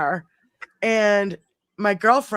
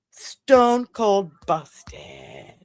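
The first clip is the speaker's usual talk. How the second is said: slow, deliberate verdict; 3 semitones lower, much slower than usual. Retold or imitated. Telling sister she's caught.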